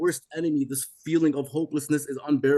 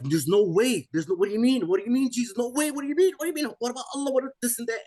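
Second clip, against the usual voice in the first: much higher, frantic questioning; panicked disbelief; 9 semitones higher, faster than usual. Retold or imitated. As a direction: plead in shocked disbelief. Panicked disbelief at facing hell.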